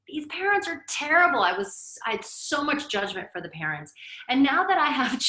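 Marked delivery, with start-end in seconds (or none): 0.1-1.4 judgmental. Retold or imitated. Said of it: "I was like".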